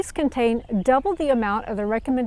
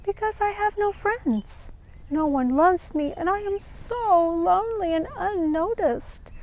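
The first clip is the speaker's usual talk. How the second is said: high, small voice of a sad little flower; a shy flower holding back tears; little flower, tearful; 7 semitones higher than usual. Acted. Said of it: "held back a tear and said".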